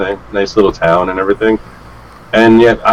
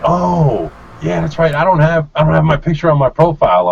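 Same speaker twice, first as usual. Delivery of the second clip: higher-pitched voice of the biker catching on; acted sheepish admission, pitched up; imitated dawning realization; 9 semitones higher than usual. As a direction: let the oh show it just clicked. Biker realizes his mistake, retold.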